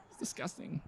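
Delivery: hushed, airy mock revulsion; disgusted cow. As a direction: sigh it out softly, appalled. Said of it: "she went and told her other girlfriend".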